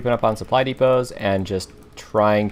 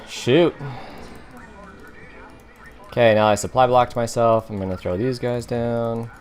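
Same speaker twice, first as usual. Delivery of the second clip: normal talking voice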